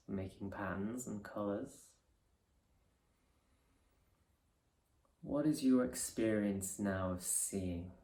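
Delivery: soft, unhurried meditation voice with long pauses; guided meditation. Acted. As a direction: ease them gently into resting awareness.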